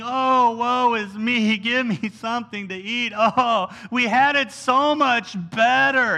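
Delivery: slow, hammed-up self-pity; whining, complaining. Preacher imitates the rabble griping about food, exaggerated whining. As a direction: drag out the words in a mock whine. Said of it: "they're just whining, complaining".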